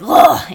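loud, high "ugh" of disgust; mock revulsion, groaned out; hammed-up grossed-out noise; imitated disgusted groan. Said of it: "in disgust".

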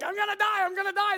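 high, loud mock wail of terror; panicked kid. Preacher acts out a kid slipping on a ride, mock panic. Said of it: "the kid is traumatized".

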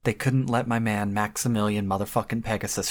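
ordinary talk

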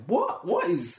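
high-pitched mock disbelief; retold bewilderment. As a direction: act out a baffled double take. Retells own bewilderment at the chaos.

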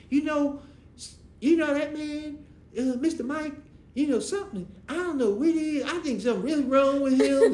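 high, loud, chattering woman's voice; high gossiping woman. Retold or imitated. Mom passing on what her niece said, high. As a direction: gossip it breathlessly to him.

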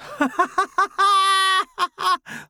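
high, loud mocking cackle; mocking laugh. A narrator's reading. Tommy laughs at the word viable, high mocking cackle. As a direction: laugh at him, scornful. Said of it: "Tommy laughs".

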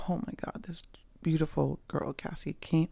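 hushed whisper of amazement; soft breathless aside; awed whisper. Real, not acted. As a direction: whisper it, touched. Awed at a friend's gift.

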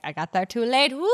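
howling the line like a wolf; hammed-up howl, rising to a woo; mock howl. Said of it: "wanted to howl his own pain".

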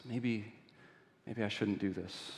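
soft, low, murmured doubt; hesitant second thoughts. Acted. Thief wavers beside the sleeping bishop, hesitant and quiet. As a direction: quietly talk yourself out of it.

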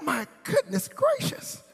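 high, slow, hammed-up delight; dramatic food rapture. Husband raving over his food, theatrically delighted. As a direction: squeal it slowly in mock awe.

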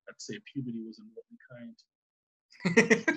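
low, slow mock Brain; deadpan it low and slow. Brain's retort quoted.

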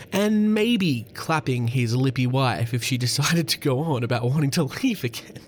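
ordinary delivery

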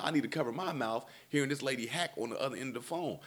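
usual speaking voice

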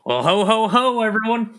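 Santa voice. Acted. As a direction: boom it out like a cheery Santa. Santa greeting in full costume.

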